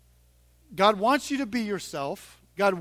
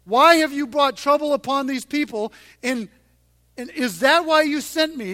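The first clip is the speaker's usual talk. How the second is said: louder pleading questions to God; anguished complaint; 6 semitones higher, 7 dB louder than usual. Retold or imitated. Moses questioning God, raised anguished plea. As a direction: cry out in confusion and pain. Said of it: "hear the sarcasm in Moses' voice".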